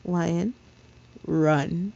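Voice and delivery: a hint of a low, dark urgency; understated low dark command. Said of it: "he said in a low, dark voice".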